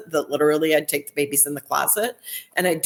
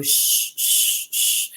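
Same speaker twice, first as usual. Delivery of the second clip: demonstrating shushing; much slower than usual. Shown as a demonstration. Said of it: "That's supposed to be mom's heart rate".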